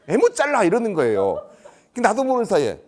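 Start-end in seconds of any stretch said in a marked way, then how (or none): 0.1-0.6 high, loud acted challenge; sudden outburst. Parent suddenly challenging the official, loud outburst. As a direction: burst out in a high demanding yell. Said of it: "근데 이 사람이 갑자기".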